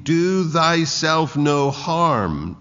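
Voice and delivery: high, loud and slow, calling out; loud cry. A narrator's reading. Paul cries out to the jailer about to kill himself, slow loud cry. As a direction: cry it out slowly to stop him. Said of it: "Paul cried out in a loud voice".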